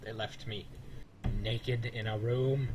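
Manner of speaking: slow dramatic complaint. Acted.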